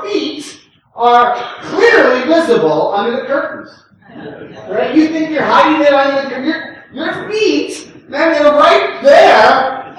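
fervent pulpit climax; preaching at full force. Acted. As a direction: rise to the sermon's peak and press the congregation.

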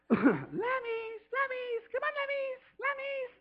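very high, drawn-out sing-song lamb call. Retold or imitated. Wife calling lambs home for the bottle, falsetto sing-song. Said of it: "I can't do it like you".

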